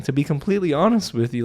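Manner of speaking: usual speaking voice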